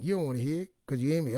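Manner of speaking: normal talking voice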